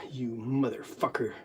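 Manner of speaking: ordinary delivery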